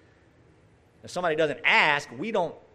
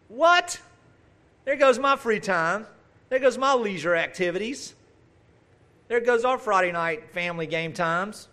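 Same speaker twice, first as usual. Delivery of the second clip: mock dismay; 6 semitones higher, 4 dB louder than usual. Mock dismay at scripture's demand.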